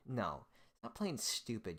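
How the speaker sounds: normal talking voice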